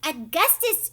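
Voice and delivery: loud, high shouted name; shouting. A narrator's reading. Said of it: "shouted Missus Gloop".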